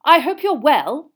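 loud, high pompous greeting; puffed-up formal politeness. A narrator's reading. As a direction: greet him like a self-important official. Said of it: "pompously shaking hands".

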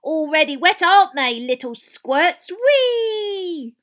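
loud, high mischievous poltergeist voice; jeer, then let out a long high squeal; Peeves, gleeful. Acted. Poltergeist bombing pupils with water, gleeful taunting. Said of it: "cackled Peeves".

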